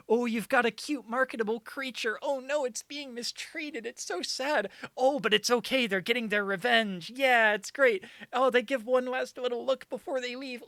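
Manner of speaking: high, sappy Disney-style narration; high gushing sarcastic movie-trailer voice; mocking voice. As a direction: parody a saccharine Disney pitch. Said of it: "because, like".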